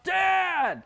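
high, drawn-out shout; screaming for dad. Acted. Kid trying to get dad's attention, high scream. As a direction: yell to get a busy parent's attention. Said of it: "or you screamed out".

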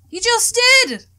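high, loud outburst; excited shout. Acted. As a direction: cut in, pointing it out loudly. Friend points out dad's greeting.

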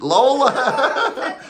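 high, loud cry breaking into laughter; laughing scold. Real, not acted. Telling the dog off for drinking, laughing shout. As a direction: shout the name, cracking up.

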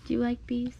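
normal talking voice

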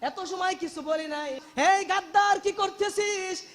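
high, forceful cry of accusation, as a preacher acts it; shouted rebuke. Retold or imitated. Old man confronting the traitors over Husain's head, shouted rebuke. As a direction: cry out in outrage, stretching the phrases. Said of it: "চিৎকার দিয়ে বলতেছে".

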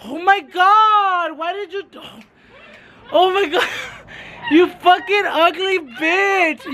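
startled high scream melting into laughter; real fright that turns into giggling; scream into giggles. Real, not acted. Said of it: "Irma came from behind and she fucking scared me".

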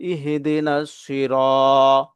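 slow ইহদিনা সিরাত with a lengthened "raa"; one-alif stretch. Shown as a demonstration. Teacher showing learners the stretch, ইহদিনা সিরাত slow with long "raa". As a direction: recite ইহদিনা সিরাত, holding the vowel after r. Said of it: "এখানে এক আলিফ টান".

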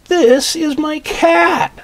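high, loud, slow joyful cry; overjoyed at finding his cat; happy. Acted. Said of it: "Look how happy he is".

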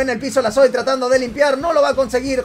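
loud, driving esports caster call; pumped-up caster voice over the match; live play-by-play. Acted. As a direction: call the action loud as it happens.

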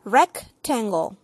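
rectangle said the English way; English pronunciation. Shown as a demonstration. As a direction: model rectangle in English for children. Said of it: "en inglés se dice rectangle".

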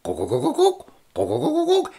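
rapid throaty glugs; gulping pour noise. Acted. Storyteller imitates honey filling a jar, quick glugs. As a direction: glug like liquid filling a jar.

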